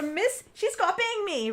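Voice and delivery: put-on tattling child. Child telling on classmate to teacher.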